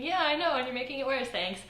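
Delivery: high-pitched, exasperated inner reply; mock-grateful comeback, dropping back on the last word; sarcastic retort. Thinking back at people who notice her blush, sarcastic.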